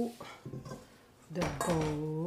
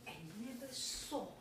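ordinary talk, nothing marked

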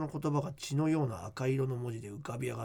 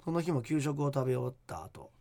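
hushed creepy ghost story; 3 semitones lower than usual. Acted. Reads listener ghost letter.

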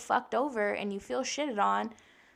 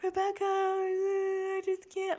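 high, drawn-out wail; exaggerated tearful whine, stretched long and high; mock bawling; 10 semitones higher, slower than usual. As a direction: imitate yourself bawling to your counselor. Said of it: "I was just bawling".

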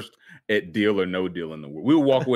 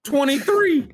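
high exasperated; 4 dB louder, slower than usual. Real, not acted. Groaning at a worse score.

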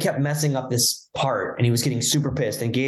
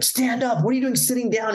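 high-pitched mock yell, little added volume; retold yell; 11 semitones higher than usual. Producer to a sitting musician while recording, retold high yell. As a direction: imitate a producer ordering someone up. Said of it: "he'd yell at Nick".

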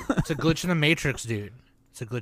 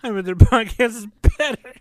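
mock crying; 9 semitones higher, 6 dB louder than usual. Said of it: "I imagined him putting the Pepe crying running emoji".

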